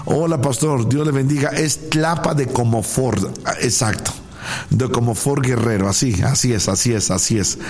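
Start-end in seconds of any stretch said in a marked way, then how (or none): none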